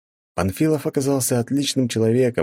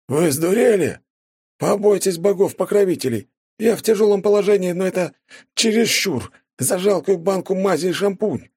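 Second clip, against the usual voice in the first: high, loud indignant outburst; 13 semitones higher, 3 dB louder than usual. A narrator's reading. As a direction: protest in outrage at the price. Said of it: "возмутился Панфилов".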